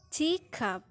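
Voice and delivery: "teacup" with a high-pitched TEA; first-syllable stress. Shown as a demonstration. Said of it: "fall on the first syllable".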